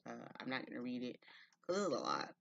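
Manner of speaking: ordinary talk, nothing marked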